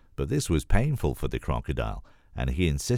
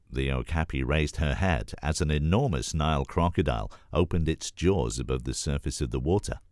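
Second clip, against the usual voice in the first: plain reading voice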